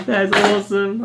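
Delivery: high, loud, laughing through the words; laughing delight. Retold or imitated. Teller reacts to his friend's joke, laughing and delighted. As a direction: crack up while praising it.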